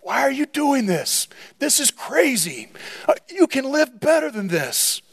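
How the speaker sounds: high-pitched voice of the tempter; tempter's coaxing. Acted. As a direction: urge like a tempter, pitched well above normal. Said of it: "as the tempter comes and whispers in your ear".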